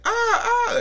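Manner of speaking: high-pitched imitated scream; grandmother screaming. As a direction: mimic a startled grandmother's shrieks. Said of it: "she just starts screaming".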